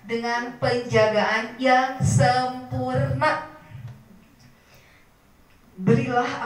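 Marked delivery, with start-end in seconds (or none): 0.0-3.5 slowed, half-sung phrase to write down; slow for note-taking. Shown as a demonstration. Teacher dictating the prayer to the mothers, slow half-sung. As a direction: dictate it slowly and clearly. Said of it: "catat ya".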